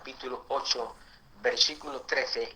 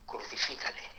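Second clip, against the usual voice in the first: an angry crowd's cry whispered, much softer; 3 semitones lower, 14 dB quieter than usual. Retold or imitated. Preacher retells the furious crowd at Pilate, whispered. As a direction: hiss the demand under your breath. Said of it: "gritaba diciendo".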